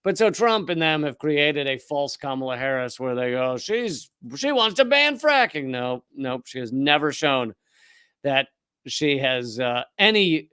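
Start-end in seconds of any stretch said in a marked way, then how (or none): 3.6-5.6 mocking quote. Mocking Trump's claim about Kamala.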